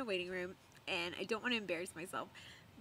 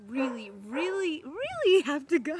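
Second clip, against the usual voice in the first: rising, louder desperate plea; desperate pleading; 6 semitones higher, 9 dB louder than usual. Retold or imitated. Pleading with the nurse to use the restroom, rising and louder. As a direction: beg the nurse, voice climbing.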